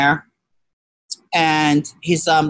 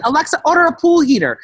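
loud command; 8 semitones higher, 3 dB louder than usual. Retold or imitated. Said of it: "drunk order to Alexa".

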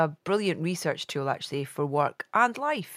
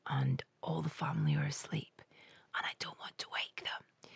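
hushed late-night whisper; 9 dB quieter than usual. Real, not acted. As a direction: whisper so the family sleeps. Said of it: "I do know why I'm whispering".

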